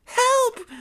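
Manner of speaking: very high, loud scream; screaming for help. Acted. Bitten woman begging the gang for help, screaming. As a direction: scream it in pain. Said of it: "screamed the woman".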